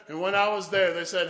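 normal talking voice

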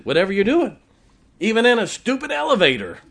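high, loud, enthusiastic; retelling Malachi's praise; excited quote. Retold or imitated. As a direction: gush about it.

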